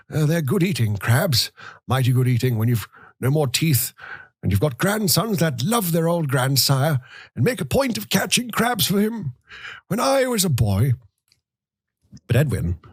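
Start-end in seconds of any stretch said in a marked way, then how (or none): 0.1-10.9 put-on high, thin voice of a grumbling old man; old grandfather. A narrator's reading. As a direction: reminisce like a frail, complaining grandfather.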